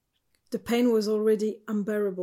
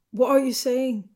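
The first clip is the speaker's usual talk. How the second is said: louder, startled demand for an answer; alarmed question; 3 semitones higher, 6 dB louder than usual. A narrator's reading. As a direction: demand it in raised disbelief. Shocked reply in lovers' breakup.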